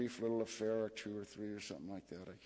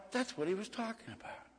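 high-pitched dawning realization; retold realization; 10 semitones higher than usual. Recalling her hanging up on him, high-pitched realization. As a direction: act out the penny dropping, voice lifted high. Sudden realization after being hung up on.

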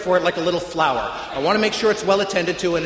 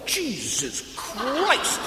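slow, drawn-out New York exclamation; a lightly voiced New York guy, slowly stunned; a hint of New York accent, drawn-out disbelief; New York shock; 6 semitones higher, 3 dB quieter, much slower than usual. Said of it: "heavy New York accent".

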